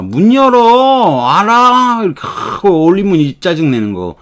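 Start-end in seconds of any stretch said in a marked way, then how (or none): 0.0-2.0 high, annoyed rising demand; irritated demand. Shown as a demonstration. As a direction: snap it out, fed up. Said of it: "이렇게 하면 이 짜증 내는 거예요".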